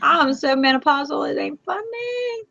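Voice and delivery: high, tearful whine, slowing at the end; on the verge of tears. Real, not acted. Telling viewers she is menopausal, tearfully exasperated. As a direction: groan and whimper it out, fighting tears. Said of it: "Who else am I making cry today? I am".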